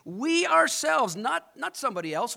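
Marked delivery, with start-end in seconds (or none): 0.0-1.1 WE OURSELVES pitched high and drawn out; emphatic. Acted. Crowd setting itself up against Jesus, emphatic. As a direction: lean slowly and forcefully on WE OURSELVES. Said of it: "is emphatic in the Greek here".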